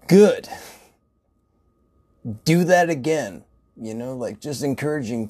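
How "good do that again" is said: loud, high praise; enthusiastic cheer. Retold or imitated. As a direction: cheer them on like a coach. Cheering on a customer.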